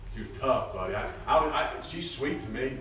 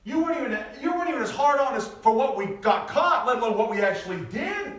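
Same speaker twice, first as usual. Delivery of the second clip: higher, louder disbelieving retort; incredulous protest; 6 dB louder than usual. Retold or imitated. Preacher retells brushing off his mother's apology. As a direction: scoff at her guilt. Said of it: "I said".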